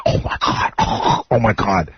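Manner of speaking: hushed, voiceless gasped swoon; mock awe. Name dropper swoons over an actress, mock hushed awe. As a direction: imitate someone breathless over a star.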